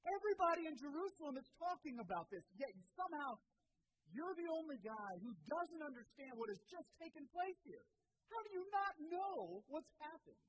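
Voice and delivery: are you kidding me, rising in disbelief; incredulous disbelief. Retold or imitated. Cleopas to the stranger on the road, exasperated and incredulous.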